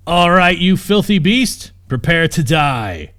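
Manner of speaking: loud, slow battle cry at the beast; defiant challenge. Acted. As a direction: bellow it out, dragging each word. Knight challenges sleeping dragon.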